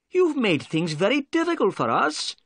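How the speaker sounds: angry. Acted. Inspector Jones to the prisoner, crossly reproaching him. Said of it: "cried Inspector Jones angrily".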